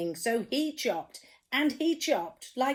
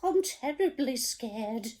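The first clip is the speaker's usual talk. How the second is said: high, whimpering "I'm scared"; 7 semitones higher than usual. Acted. Storyteller voicing the cook, high and whimpering. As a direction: whimper it like a timid child. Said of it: "the cook declared".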